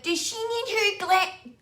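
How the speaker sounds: high, slow, theatrical groomer voice; imitating the groomer. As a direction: drawl it like a showy diva. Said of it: "she had a voice like that".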